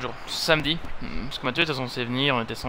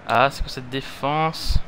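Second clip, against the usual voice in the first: normal talking voice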